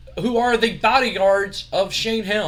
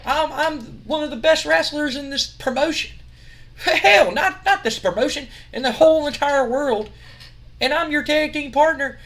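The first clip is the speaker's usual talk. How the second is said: indignant boast; 9 semitones higher than usual. Retold or imitated. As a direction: puff up and protest your greatness.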